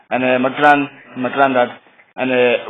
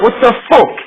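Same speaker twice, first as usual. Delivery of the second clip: high, loud mock outrage; angry outburst; 9 semitones higher, 5 dB louder than usual. Raver snapping at old lady in queue, mock angry. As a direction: snap it back, imitating his own rage.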